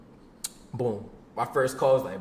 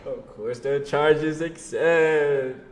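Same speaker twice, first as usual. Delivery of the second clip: each word stretched out slowly; milk the punchline, dragging every word; slow dramatic drawl; much slower than usual. Acted. Telling a friend the call got through, slow drawn-out punchline.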